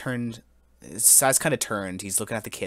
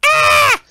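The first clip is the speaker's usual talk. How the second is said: short, piercing mock scream, very high and loud; imitated scream; 15 dB louder than usual. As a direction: squeal it out like a frightened kid. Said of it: "It was kinda like".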